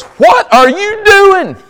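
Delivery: very high, loud, drawn-out comic squawk; talking donkey. Acted. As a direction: play an exasperated donkey, slow and shrieky. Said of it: "The donkey turns around and goes".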